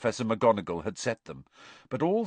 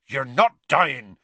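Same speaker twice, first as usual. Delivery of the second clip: Hagrid; 4 semitones higher, 7 dB louder, slower than usual. A narrator's reading.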